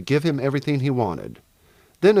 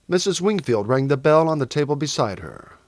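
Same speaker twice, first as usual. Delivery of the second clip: usual speaking voice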